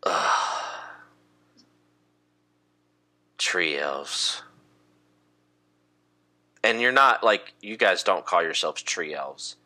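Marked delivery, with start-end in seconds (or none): 0.0-4.4 low, drawn-out disgusted sigh; sigh, then drop low and slow with contempt; eye-rolling disdain. Acted. Elf sneering at the woodland elves, eye-rolling disdain. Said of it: "rolls his eyes and goes".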